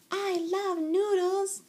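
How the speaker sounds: high, drawn-out little-sister voice; little girl declaring. A narrator's reading. Girl helping Mama in kitchen, gushing declaration. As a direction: declare your love high and slowly. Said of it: "declared Jilly Boo".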